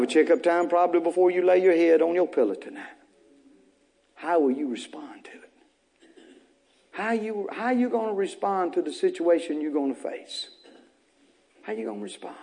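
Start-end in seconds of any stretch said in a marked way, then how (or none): 4.1-5.5 hushed, breathy question; whisper. Real, not acted. Preacher whispering to congregation, hushed question. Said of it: "whispered question to church". 6.9-12.3 soft, quieter questioning; hushed. Real, not acted. Preacher quietly pressing church, soft question.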